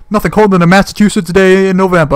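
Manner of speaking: loud, high mock Boston bro; fake Boston streamer. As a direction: brag like a dim Boston guy on a livestream. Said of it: "he's talking like an idiot".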